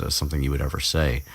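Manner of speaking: ordinary delivery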